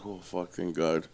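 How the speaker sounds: low, soft and slow sigh of relief; quiet relief. Retold or imitated. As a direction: breathe it out, glad the danger passed. Relief after bikers leave.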